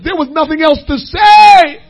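loud, ringing sermon build; full-force preaching. Acted. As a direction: preach it out to the congregation. Preaching climax.

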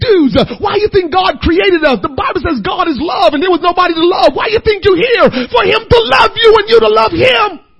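very loud, high, fierce demand; shouted challenge. Retold or imitated. Preacher retelling how he confronted the men, shouting. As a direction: shout it right in their faces.

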